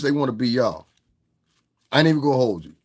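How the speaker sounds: usual speaking voice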